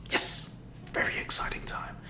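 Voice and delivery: breathy whispered aside; whispering. Real, not acted. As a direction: whisper it like a secret. Whispered aside about rating.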